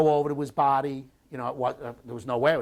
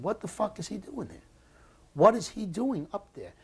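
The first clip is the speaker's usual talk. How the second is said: higher-pitched retold alarm; alarmed disbelief; 8 semitones higher than usual. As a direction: ask it as if you can't believe what he's doing. Retelling alarm at comrade running ahead.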